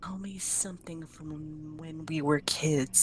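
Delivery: hushed, soft in-character whisper; secret whisper. Acted. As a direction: whisper it close, for one listener only. Stepping close for a secret exchange.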